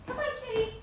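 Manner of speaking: very high, soft coaxing voice; talking to the cat. Real, not acted. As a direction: coax the kitty over. Feeding the cat.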